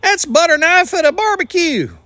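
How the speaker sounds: hammed-up incredulous plea; incredulous Gideon. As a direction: protest to God in high-pitched astonishment. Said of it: "Imagine Gideon's face".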